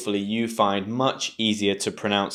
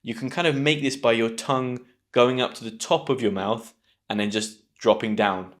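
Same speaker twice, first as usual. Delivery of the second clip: usual speaking voice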